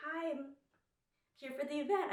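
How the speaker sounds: high, put-on influencer greeting. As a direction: greet the bouncer in your best influencer voice. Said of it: "my best, like, influencer voice".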